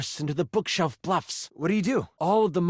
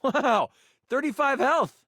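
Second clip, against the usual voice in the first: higher, a real little wow of surprise; delighted to see the health jump; pleasantly surprised; 9 semitones higher than usual. Real, not acted. Player reading out the new health total, pleasantly surprised. Reacts to game stat gain.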